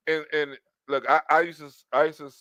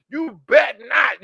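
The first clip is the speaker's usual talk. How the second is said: slow, higher, louder warning; drawn-out threat; 6 semitones higher, 6 dB louder, slower than usual. Retold or imitated. Woman threatening him, slow sassy warning. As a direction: stretch the threat out.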